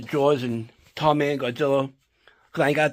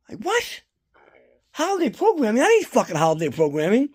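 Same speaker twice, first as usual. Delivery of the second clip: high, squeaky incredulous repeat; mocking high-pitched disbelief; indignant disbelief; 4 semitones higher than usual. Real, not acted. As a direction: echo the phrase back as if it's absurd. Outraged at TV schedule change.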